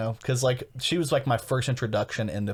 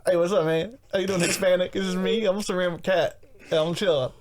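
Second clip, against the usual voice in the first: high, louder put-on cat voice; ceramic cat character; 8 semitones higher, 4 dB louder than usual. Introducing a new ceramic cat character, silly high voice. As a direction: play a chill cat in a raised voice. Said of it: "That's my new character".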